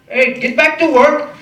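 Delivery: high, loud, slow shouted taunt; yelled heckle. Retold or imitated. Construction workers yelling at the comic. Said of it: "they're like".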